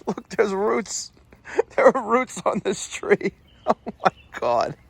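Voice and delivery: talking through real laughter; laughing. Real, not acted. Amazed technician showing viewers tree roots, laughing. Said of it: "I shouldn't be laughing".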